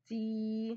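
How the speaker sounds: "تي" drawn out slowly on a level note; slow teacher's sounding-out of "تي", vowel lengthened; stretched vowel. Shown as a demonstration. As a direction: hold the ee of "تي". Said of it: "مديت الصوت قوي".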